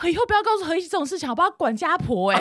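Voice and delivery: quick grumble behind someone's back; Kimi. Retold or imitated. Imitating a coworker privately grumbling to another, lightly mocking. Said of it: "模仿得好像哦".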